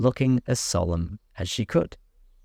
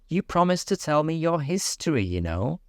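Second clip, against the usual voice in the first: Alice voice; 7 semitones higher than usual. A narrator's reading. Alice speaks to mouse.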